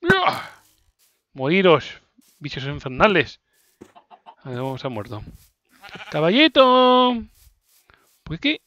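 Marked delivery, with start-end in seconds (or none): 0.1-0.6 high startled shriek. Real, not acted. Startled scream. 6.1-7.2 high, slow call. Real, not acted. Calling out to horse.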